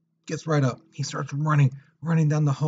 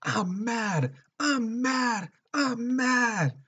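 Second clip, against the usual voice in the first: high-pitched, wailing mock yell; imitated frenzied yelling; 11 semitones higher, much slower than usual. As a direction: cry it out like a crazed attacker, far above your usual pitch. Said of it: "He's all yelling as loud as he can".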